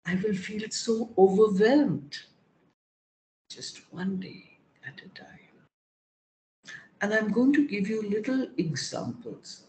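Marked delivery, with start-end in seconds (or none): 3.5-5.4 hushed whisper to herself. Acted. Whispering a reminder to get through the day. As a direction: whisper it like a private mantra.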